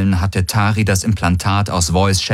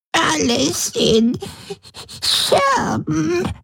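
much higher, slow crying whimper; much slower than usual. A narrator's reading. Broken Voi whimpers to Ylana, crying. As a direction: whimper it out through tears. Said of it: "wimmerte sie".

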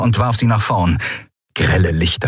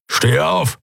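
growled command, pitched up; growly order; 8 semitones higher than usual. A narrator's reading. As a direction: rumble out a rough order. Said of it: "brummte er".

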